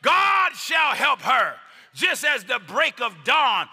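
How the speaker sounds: high, loud, slow emphatic scripture shout; reading with emphasis. Acted. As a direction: proclaim the psalm with full force. Preaching scripture at full force.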